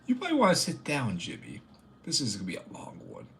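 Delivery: a hint of an old storyteller; lightly voiced grandpa. Grandpa answering the grandchild, lightly put-on storyteller voice. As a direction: settle in as a mock grandpa with a tale. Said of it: "Grandpa, tell us a story about".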